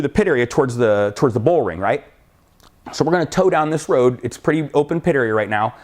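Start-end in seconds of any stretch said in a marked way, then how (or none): none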